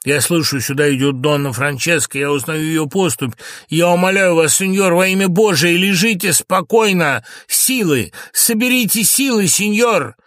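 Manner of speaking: agitation building to a high, loud plea; starts contained, climbs to an urgent cry; greatly agitated. Acted. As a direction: implore him with mounting urgency. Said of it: "В сильном волнении".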